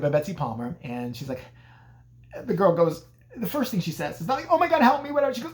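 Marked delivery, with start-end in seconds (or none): 4.5-5.3 louder, high-pitched cry for help; frantic mock plea. Terrified girl meeting a stranger, frantic mock plea. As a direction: beg for help in hammed-up panic.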